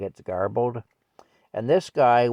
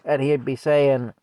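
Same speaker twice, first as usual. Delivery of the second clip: usual speaking voice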